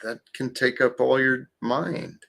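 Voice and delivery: ordinary talk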